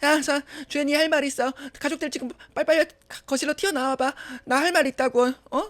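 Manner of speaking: high, urgent calling out; summoning everyone. Acted. Calling the family together. (다급하게 불러 모으는 말투)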